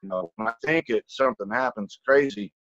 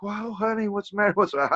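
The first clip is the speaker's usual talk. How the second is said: put-on concerned girlfriend; 9 semitones higher, faster than usual. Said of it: "And she's like".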